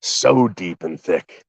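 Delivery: ordinary delivery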